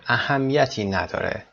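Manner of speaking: usual speaking voice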